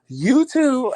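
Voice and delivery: high, sing-song you too; a high perky coworker voice; chirpy coworker. Retold or imitated. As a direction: mimic her bright reply.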